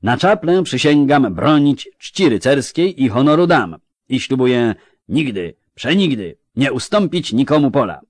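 high, loud baron's oath; exclaimed knightly oath. Acted. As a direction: proclaim a solemn pledge to the hall. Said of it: "zakrzyknął, wstając i wznosząc puchar".

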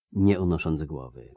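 ordinary delivery

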